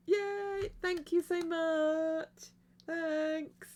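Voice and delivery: high, gushing slow thank you; excited thanks. Real, not acted. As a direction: squeal with real delight. Thanking a viewer on stream.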